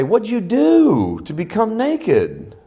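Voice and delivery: high, slow, exaggerated scolding question; hammed-up outraged demand; mock accusing God. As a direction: stretch it out in mock reproach. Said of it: "God doesn't say".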